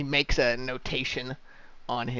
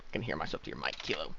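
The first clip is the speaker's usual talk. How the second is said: normal talking voice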